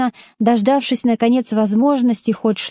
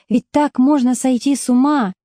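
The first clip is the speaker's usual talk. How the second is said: ordinary delivery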